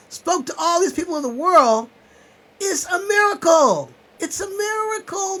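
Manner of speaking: high, loud, crying as he speaks; real tears of gratitude, loud and high; tearful outburst. Real, not acted. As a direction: voice cracking with emotion, slowing down. Overcome telling of his life.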